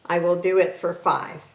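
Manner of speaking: ordinary talk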